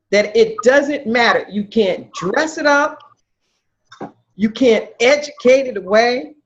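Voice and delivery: usual speaking voice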